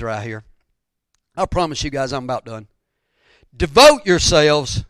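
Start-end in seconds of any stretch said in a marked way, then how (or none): 3.6-4.8 loud, slow preacher's exhortation; forceful and deliberate, at full voice; emphatic repetition. Acted. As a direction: drive the phrase home, drawn out. Preacher repeats scripture phrase for emphasis.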